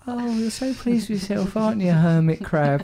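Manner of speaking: mock teasing. Real, not acted. Teasing co-host as hermit crab.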